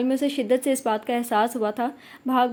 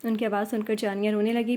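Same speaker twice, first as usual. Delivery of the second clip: ordinary delivery, nothing marked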